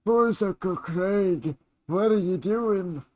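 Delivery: put-on high, slow strained old man voice; creaky elderly character voice; old man Forza Craig. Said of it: "can't do an old man voice and Forza Craig voice".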